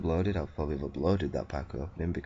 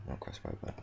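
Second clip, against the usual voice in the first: breathy whisper, much softer; whispered self-correction, "cross right bar" half-swallowed; 10 dB quieter than usual. Real, not acted. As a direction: hiss the wrong words under your breath.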